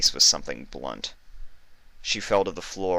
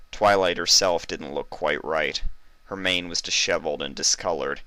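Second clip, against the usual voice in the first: plain reading voice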